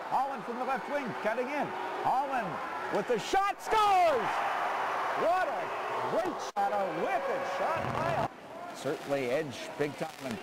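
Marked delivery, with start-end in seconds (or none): 0.1-8.3 high rising call into a shout; long goal yell. Real, not acted. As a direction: build then explode on scores.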